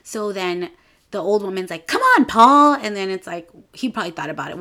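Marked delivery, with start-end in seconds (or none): 1.9-2.7 put-on high, loud, begging old woman; a loud, high plea from an old lady; pleading old lady. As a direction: whine it up high like a needy old client. Old lady client begging Paul.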